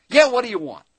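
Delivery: loud, high, surly shout from the back; the same gruff clerk again; rude clerk. Retold or imitated. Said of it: "a voice comes back from the back".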